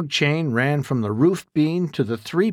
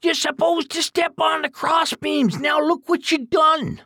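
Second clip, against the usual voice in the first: much higher scolding shout; exasperated old man yelling; angry roar. Acted. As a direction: berate him for missing the beams. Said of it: "roared Duke again".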